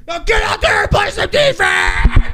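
full-blast, high screamed coach order; hammed-up scream to send her into battle; mock sideline screaming, way up high; retold yelling. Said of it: "And I, like, yelled at".

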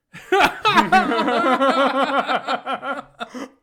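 long, loud, high howl of laughter; roaring laugh. Real, not acted. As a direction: belly laugh at the memory. Laughing at his bad move.

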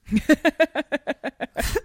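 short bright laugh at own joke; a real laugh after a wry remark; amused laugh. Real, not acted. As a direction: laugh it off lightly.

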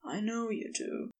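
very soft, hoarse murmur; hushed and husky; hoarse whisper. A narrator's reading. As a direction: sigh it out hoarsely, barely above a whisper. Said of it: "He sighed and whispered hoarsely".

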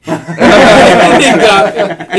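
loud burst of laughter through the words; laughing hard. Real, not acted. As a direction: crack up laughing. Laughing at scene.

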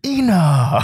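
loud, laughing celebrity impression; impression of Bobby Choi's catchphrase. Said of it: "this next 성대모사 ... impressions".

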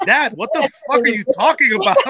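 high, loud, laughing disbelief; laughing outraged retort. Retold or imitated. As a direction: blurt it out half laughing at dad. April retorts to her dad.

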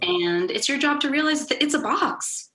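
passionate. Real, not acted. Building passionate rant.

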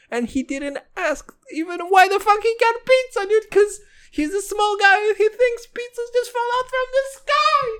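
high-pitched whiny rant about brother. Pretend. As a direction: whine it up high and strained.

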